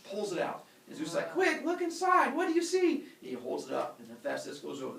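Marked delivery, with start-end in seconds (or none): 1.3-3.0 loud, high-pitched frantic questioning; mock urgency. Zeus urges Hephaestus to look in his head, high loud urgency. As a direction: act out an eager, urgent demand.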